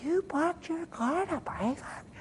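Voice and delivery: high, soft, slow astonished question; hushed high-pitched incredulity, drawn out; retold disbelief. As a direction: imitate her touched amazement. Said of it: "She said".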